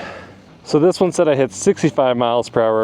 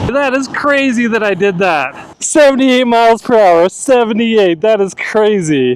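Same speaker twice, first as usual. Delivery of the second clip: excited; 6 semitones higher, 5 dB louder than usual. Real, not acted. Just hit top speed.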